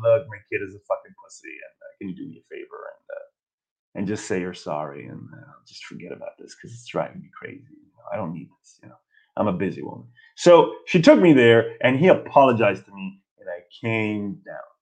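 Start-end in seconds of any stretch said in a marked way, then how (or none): 0.0-10.1 low, fast, hushed exasperated mom; imitating mom on phone. Mom calling the barber, hushed and exasperated. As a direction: mutter it like a fed-up mother on a phone call. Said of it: "my mom probably called him".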